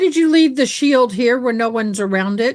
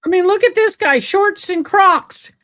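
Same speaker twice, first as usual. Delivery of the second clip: higher-pitched disbelief, drawing out the last words; incredulous; 7 semitones higher than usual. Real, not acted. Commentator pointing out an officer in shorts and Crocs, incredulous. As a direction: point out something absurd, incredulously.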